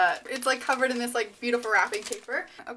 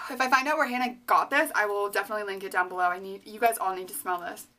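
ordinary delivery

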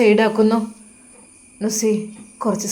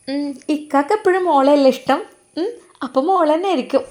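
put-on higher, brighter young wife's voice; young wife Nusaiba; 8 semitones higher, 3 dB louder than usual. Wife Nusaiba teasing her husband, in a higher young woman's voice. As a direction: voice the wife, pitched well up and playful.

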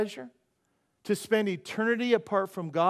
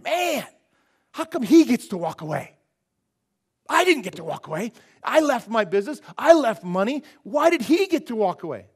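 loud, higher indignant complaint; Peter; 4 semitones higher, 9 dB louder than usual. Acted. Disciple complains about another walking away, acted indignation. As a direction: protest the unfairness with loud, punched phrases. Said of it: "Peter's kinda saying".

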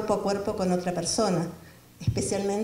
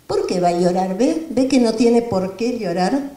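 put-on loud, hearty dad cooing at a baby boy; voicing the father; 6 dB louder than usual. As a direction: play the proud father, booming and playful. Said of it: "El papá le dice al bebé". (padre hablando fuerte y juguetón al bebé)

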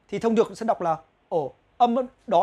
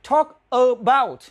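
slow, separated words; 2 semitones higher, 5 dB louder, much slower than usual. Shown as a demonstration. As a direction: TALK ABOUT said slowly, word by word. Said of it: "nhưng đọc nhanh lên thì". (nói Talk about chậm, tách từng từ)

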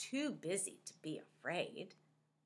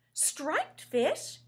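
high, eager question; excited question; 8 semitones higher, 5 dB louder than usual. Acted. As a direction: perk up, hopeful. Rainbow Fish perks up.

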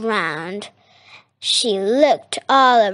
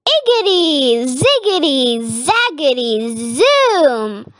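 high rhythmic incantation, each word separated; witch's spell; 3 semitones higher than usual. A narrator's reading. Witch stirring her cauldron, chanting a spell high. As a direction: chant the magic words in a raised voice. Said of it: "she muttered a spell".

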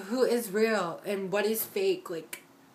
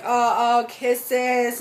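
much louder gushing fake kisses; mock fake affection; 3 semitones higher, 8 dB louder, much slower than usual. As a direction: ham up a two-faced hug greeting. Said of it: "hug you and be like".

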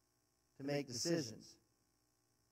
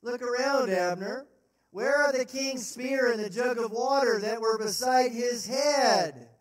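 loud, high call out; David taunting Abner; shouted taunt; 5 semitones higher, 6 dB louder than usual. Acted. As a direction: show him what you took.

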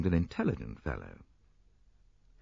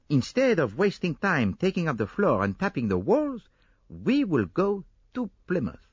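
higher Belgian detective, briskly reasoning; confident Poirot; 7 semitones higher than usual. Acted. Poirot explaining his deduction to Hastings, higher confident detective voice. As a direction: lay out the plan with certainty.

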